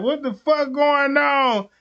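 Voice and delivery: high, slow mock cop barking on arrival; a cop storming in, demanding answers.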